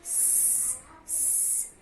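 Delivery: س hissed out unvoiced, very soft; just hiss the س like a snake; breathy hissed s for the class; hissed s sound. Shown as a demonstration. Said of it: "بنقراه س".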